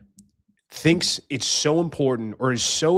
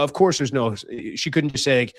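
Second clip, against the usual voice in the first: ordinary talk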